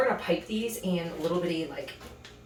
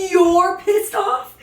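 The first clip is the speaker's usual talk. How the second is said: much higher and louder, slow, stunned; indignant echo; 11 semitones higher, 11 dB louder, much slower than usual. Retold or imitated. Echoing the boyfriend's complaint back at him, shouted slow disbelief. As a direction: throw his words back, slowed and outraged.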